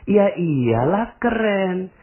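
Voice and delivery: slow, high, drawn out insistence; emphatic agreement. Shown as a demonstration. Answering a doubter about the official, slow and emphatic. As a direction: insist it really is cool. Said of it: "Kok keren, Pak Nas?".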